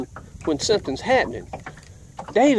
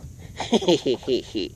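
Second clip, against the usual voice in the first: imitating a sneaky little snicker; sneaky laugh; 5 semitones lower, 3 dB quieter than usual. Boy snickering at the storyteller over his bicycle, imitated sneaky laugh. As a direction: mimic a sly "hee hee hee". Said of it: "that sneaky laugh".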